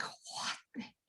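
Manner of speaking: hushed, voiceless amazement; barely audible astonished aside; stunned whisper. Acted. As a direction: whisper it to yourself in disbelief. Retelling her astonished reaction to dust.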